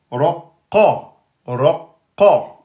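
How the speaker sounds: "Raqa" with heavy r and q; teacher model; model syllables. Shown as a demonstration. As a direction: say "Raqa" twice. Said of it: "on met la voyelle".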